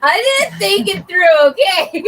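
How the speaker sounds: high, loud, drawn-out plea through laughter; squealed self-defence, laughing; laughing protest. Real, not acted. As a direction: protest loudly while cracking up. Defends herself while laughing.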